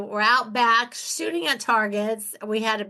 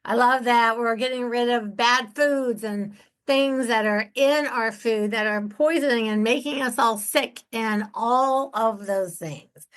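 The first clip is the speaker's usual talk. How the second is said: ordinary talk, nothing marked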